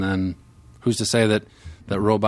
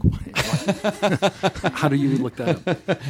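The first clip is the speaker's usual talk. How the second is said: imitating a confused coworker; 7 semitones higher, slower than usual. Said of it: "She's like".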